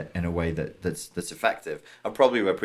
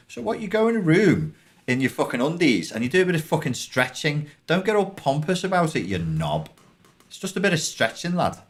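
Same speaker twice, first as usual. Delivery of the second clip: higher, mocking rant at a yoga snob; mock exasperation; 6 semitones higher than usual. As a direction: scold the pompous one. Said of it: "I'm like".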